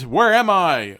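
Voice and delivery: high, loud, urgent question; alarmed, insistent cry; demanding shout. A narrator's reading. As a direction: demand it at a yell. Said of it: "you demand".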